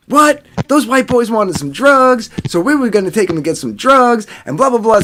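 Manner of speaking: high-pitched mock indignation; indignant woman imitation. Girl explaining herself to cops, high indignant imitation. Girl's indignant reply to accusation acted.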